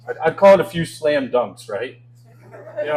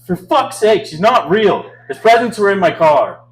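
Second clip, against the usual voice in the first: higher, louder exasperated outburst; snapping mom; 6 semitones higher, 8 dB louder than usual. Retold or imitated. Comic retelling mom snapping at her nine-year-old, exasperated yell. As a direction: snap it out like a fed-up mom. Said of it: "she snaps".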